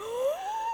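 sharp high gasp of sudden joy; a playful imitated gasp, very high; mock delighted gasp. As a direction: gasp like the answer just lit him up. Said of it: "And he's just like".

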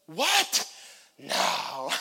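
an annoyed, exasperated David; irritated snap. Shown as a demonstration. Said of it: "This is how I read it in my mind".

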